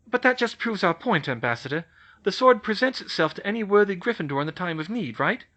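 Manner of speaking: a hint of a brisk schoolgirl; Hermione. Hermione presses the ambassador.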